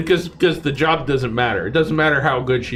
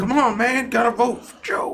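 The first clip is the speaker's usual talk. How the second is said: mock Biden catchphrase; 7 semitones higher than usual.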